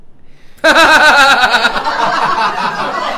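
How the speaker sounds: pulsing, juddering stuttered noise from the belly; mock blubber like a toad croaking; croaking toad-like wail. Straight man interrupting before the singer starts, toad-like croaking wail. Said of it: "你肚子里有蛤蟆呀".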